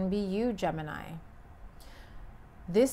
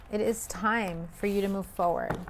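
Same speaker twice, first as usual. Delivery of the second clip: usual speaking voice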